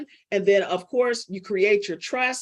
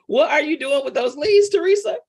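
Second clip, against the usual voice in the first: loud, high comic disbelief; mock-scold her like a stand-up comedian; Martin Lawrence; 6 semitones higher, 4 dB louder than usual. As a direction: pitch climbing to an incredulous question. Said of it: "In my Martin Lawrence voice".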